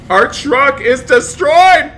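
slow, high, loud wail of dismay; dismayed shout. Pretend. Driver sees the truck destroyed, dismayed shout. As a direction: drag out the outraged cry. Laments wreck.

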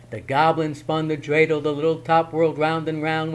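ordinary delivery

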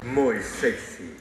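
low, slow sultry purr. Acted. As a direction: drawl it low and sexy. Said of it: "Tengo esta voz sensual".

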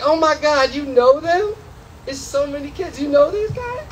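high, frightened mom; panicked mother. Retold or imitated. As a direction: squeal like a scared mom behind the wheel. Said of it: "My mother's driving like".

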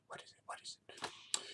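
whispered wondering question; hushed little niece. Retold or imitated. Niece wonders about the hairy mountain, hushed. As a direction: ask softly, under your breath. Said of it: "my little niece goes".

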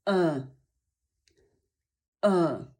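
E as a dull, falling "uh"; low frog vowel. Shown as a demonstration. Teacher sums up how to make the sound, low "uh" for E. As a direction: freeze the lips and sound E as low "uh". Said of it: "język obniża się nisko".

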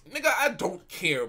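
ordinary talk

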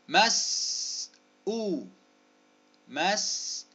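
"مسؤ" in two long, separated syllables. Shown as a demonstration. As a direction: hold the "s", pause, then lift a long "ؤ". Sounding out word for spelling.

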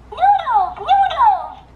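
put-on high giggly baby voice begging. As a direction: squeal noodles like a hungry toddler. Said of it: "Vocês viram ela falando noodles".

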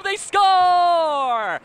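drawn-out shouted goal call; long goal yell. Real, not acted. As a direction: hold score long and loud. Goal call loud held.

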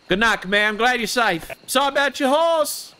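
a cheerful roleplayed cowboy; Enrique character voice. Enrique greeting his gang in game, lightly put-on.

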